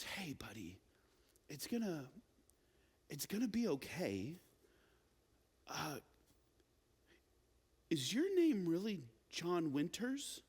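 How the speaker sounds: put-on soft, hushed reassurance to a scared child; gentle comforting. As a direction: soothe him quietly, crouched beside him. Mom squats to comfort crying boy.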